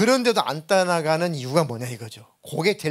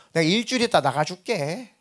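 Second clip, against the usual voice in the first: higher, sneering voice of a demon; mocking spirit; 4 semitones higher than usual. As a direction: taunt him like a smug demon. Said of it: "이렇게 조롱을 해요". (조롱하는 귀신 목소리)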